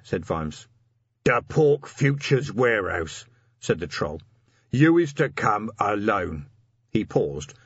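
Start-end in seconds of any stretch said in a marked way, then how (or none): 1.2-3.3 slow, slightly raised troll voice; surly troll messenger. A narrator's reading. Cornered troll messenger names the meeting place, surly and slow. 4.7-6.5 slow, slightly raised troll voice; surly troll messenger. A narrator's reading. Cornered troll messenger names the meeting place, surly and slow.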